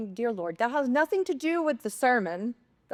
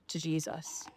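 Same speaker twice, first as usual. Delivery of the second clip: hushed whisper; 4 semitones lower, 10 dB quieter, slower than usual. Real, not acted. Preacher whispers her surrender to Jesus, hushed. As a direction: whisper to Jesus, unvoiced and soft. Tender climax of sermon story.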